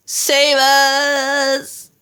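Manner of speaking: high, drawn-out cry for help; long high-pitched plea; scream. A narrator's reading. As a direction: wail it out as a terrified giant. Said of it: "screamed the flesh lump eater".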